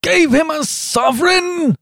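high-pitched, drawn-out cry of disbelief; incredulous holler at the top of the register; astonished shout. A narrator's reading. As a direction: shout it high, stretching out the words. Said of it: "shouted Trevor".

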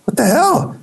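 startled outcry. Retold or imitated. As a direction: jump at the slap. Friend slaps him hard.

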